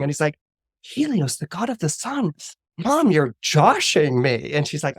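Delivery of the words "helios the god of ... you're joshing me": put-on stunned teen, pitched way up; incredulous. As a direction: question it in astonishment. Son disbelieves his mother's revelation.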